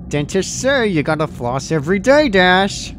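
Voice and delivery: chirpy nagging like a mock dentist; teasing Pinky. A narrator's reading. Pinky teasing the dying Dash, loud chirpy scold. Pinky's gruesome floss joke.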